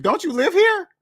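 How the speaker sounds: high, sharp disbelieving question; incredulous. Real, not acted. Jabbing at debt slaves, incredulous high question. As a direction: squeak it out in mock disbelief.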